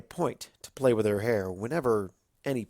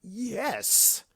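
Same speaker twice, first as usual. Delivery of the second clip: delighted yes; 11 semitones higher than usual. A narrator's reading. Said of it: "Discord said delightedly".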